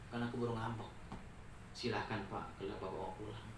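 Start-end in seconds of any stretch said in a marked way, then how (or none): 1.8-3.5 calm. Acted. Sheikh calmly lets him go.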